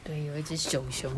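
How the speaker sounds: soft whisper; real whisper. Real, not acted. Mother whispering back to her child about the hidden bear. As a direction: hush like sharing a secret.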